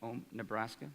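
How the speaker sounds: ordinary talk, nothing marked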